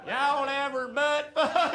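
friendly Southern country accent. Shown as a demonstration. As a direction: ask it like a cheerful Southern guy. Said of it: "You have to say butt fuck with an accent".

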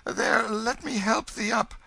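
very high, loud pitying voice; distressed benevolent stranger. A narrator's reading. Stranger helping writhing Hugo up, pitying. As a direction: fuss with anguished sympathy in a high voice. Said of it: "cried the benevolent stranger".

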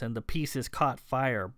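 plain reading voice

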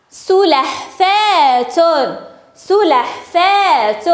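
very slow, syllable by syllable; slow sounding-out. Shown as a demonstration. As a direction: stretch the word so each sound stands out. Teacher stretches word for sound spotting.

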